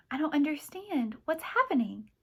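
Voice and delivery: high, sweet Charleston bewilderment; feigned confusion, Charleston accent. A narrator's reading. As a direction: play innocent in a Charleston accent. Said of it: "feigning dismay and confusion".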